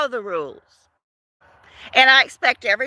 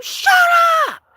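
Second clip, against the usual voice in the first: mock scream. Wanting to yell at chatty coworkers, high fed-up shout. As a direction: shout them down to shut up. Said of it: "I just wanted to just scream".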